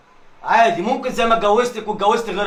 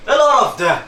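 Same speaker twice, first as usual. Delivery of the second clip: higher, louder disgusted shout; angry yell; 6 semitones higher, 4 dB louder than usual. Acted. Husband repeating his disgust, angrier now. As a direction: throw the insult at her again.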